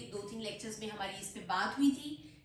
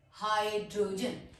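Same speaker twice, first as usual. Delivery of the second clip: split into syllables; 3 semitones lower than usual. Shown as a demonstration. Teacher dividing a long word, syllable by syllable. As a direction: sound out हाइ, draw, जन one after another. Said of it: "syllables में divide करूंगी".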